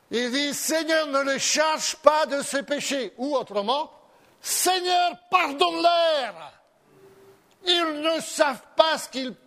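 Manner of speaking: loud, high fervent preaching; preaching at full force. Acted. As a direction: preach it to stir the congregation. (le pasteur prêche avec ferveur, d'une voix forte et haute)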